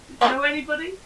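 laughs loudly through it; a high laughing burst at herself. Real, not acted. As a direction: breaks into a loud laugh. Laughing at her innocent self.

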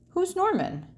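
put-on high, puzzled kid voice; a boy asking, surprised; Austin's question. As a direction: pitch way up for Austin. Friend Austin voiced in story.

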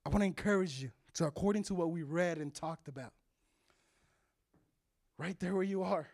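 normal talking voice

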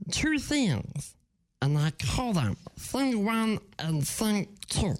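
halting little alien voice; cartoon creature impression, broken phrasing; Stitch. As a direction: squeeze out the words in short bursts. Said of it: "I'm gonna do Stitch".

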